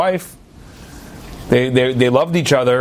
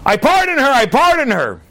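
yelling out; 11 semitones higher than usual. Retold or imitated. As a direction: shout the pardon to stop the hanging. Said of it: "He yells out from the box".

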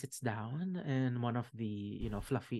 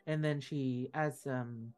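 usual speaking voice

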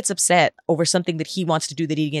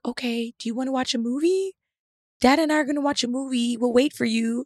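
sweet little son; 7 semitones higher, 3 dB quieter than usual. Retold or imitated. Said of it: "he was like".

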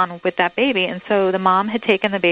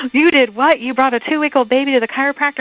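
high-pitched, incredulous mock outrage; horrified; 6 semitones higher, 3 dB louder than usual. Doctor to mother who took her baby to a chiropractor, mock horrified. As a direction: imitate an appalled reaction, pitched up and rushing into the second question. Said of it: "And he was horrified".

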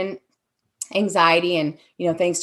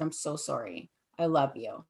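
hushed, much softer, last words whispered; soft apology; 5 semitones lower, 11 dB quieter than usual. Retold or imitated. Trafficker holding her after yelling, whispered apology. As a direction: murmur it close, tender and low.

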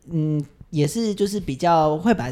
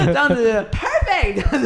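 laughing; 7 semitones higher, slower than usual. Retold or imitated. Mimicking teacher's delighted praise.

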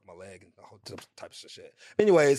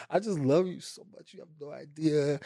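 teary confession; 4 semitones higher than usual. Retold or imitated. Teary fan telling star.

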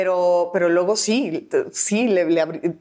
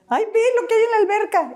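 imitating Claudia; faster than usual. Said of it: "me dice Claudia".

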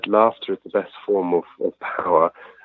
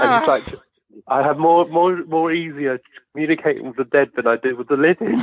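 high-pitched, laughing as they talk; helpless laughter breaking into the sentence; 8 semitones higher than usual. Real, not acted. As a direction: giggle through the words, voice climbing higher. Joking and laughing at own mishap.

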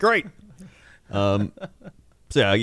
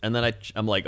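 ordinary talk

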